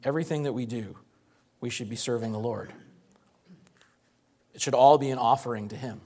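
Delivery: normal talking voice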